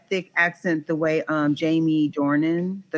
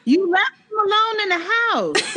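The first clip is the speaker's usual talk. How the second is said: laughing scold; 12 semitones higher than usual. Real, not acted. Friend scolding in mock disbelief.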